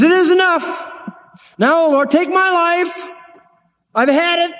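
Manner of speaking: high, strained plea of a worn-out prophet; despairing Elijah. Acted. Elijah under the juniper tree begging God to take his life, strained high plea. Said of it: "that's what I call discouragement".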